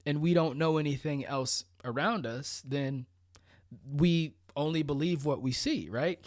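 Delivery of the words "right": ordinary talk, nothing marked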